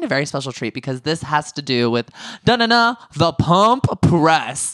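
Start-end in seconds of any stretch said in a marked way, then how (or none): 2.5-4.7 big, drawn-out segment fanfare; fanfare announcement. Pretend. Host unveiling a special treat, mock fanfare. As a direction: trumpet the segment title, loud and slow.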